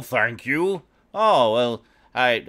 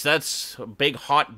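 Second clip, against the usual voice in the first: normal talking voice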